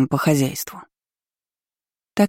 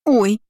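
high frightened little squeak; 7 semitones higher than usual. A narrator's reading. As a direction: squeak like a scared child. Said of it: "пискнула девочка". (испуганный писк)

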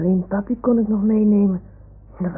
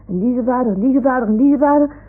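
rattling off; 4 semitones higher, 3 dB louder, faster than usual. Acted. Snel opsommen van voorvaders.

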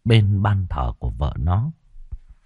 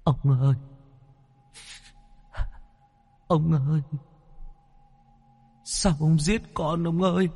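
higher, softer, wailing ghost voice; a wronged woman's spirit keening from the dark; wronged ghost weeping; 8 semitones higher, 7 dB quieter than usual. A narrator's reading. Ghost wails softly to Lý in his dream. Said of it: "tiếng khóc đầy oan ức".

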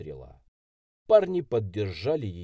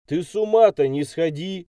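loud, raised-pitch scolding call; shout; 7 semitones higher, 8 dB louder than usual. A narrator's reading. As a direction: call out to stop her. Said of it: "крикнул я".

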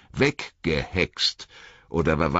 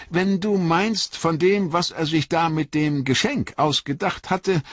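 put-on higher, lighter young hobbit voice; earnest young hobbit, pitched up; young Frodo; 11 semitones higher than usual. A narrator's reading. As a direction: lift the voice for eager young Frodo.

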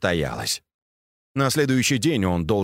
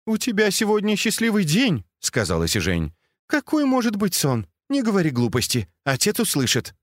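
молодая служанка; 9 semitones higher than usual. Acted. Служанка Си Жень отвечает.

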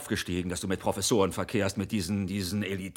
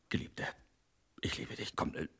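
whispered; 6 semitones lower, 11 dB quieter than usual. Acted. Seduction attempt.

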